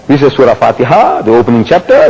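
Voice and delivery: ordinary delivery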